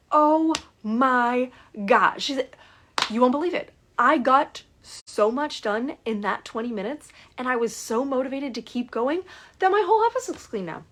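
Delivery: thrilled client raving about her clean office; excited client. Retold or imitated. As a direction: burst out with delighted news. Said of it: "she is so excited".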